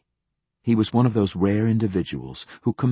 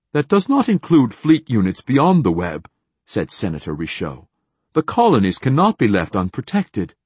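raised, insistent voice; loud objection; 4 semitones higher, 7 dB louder than usual. A narrator's reading. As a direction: push back loudly. Senator objects sharply.

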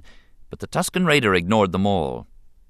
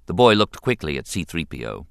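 ordinary delivery, nothing marked

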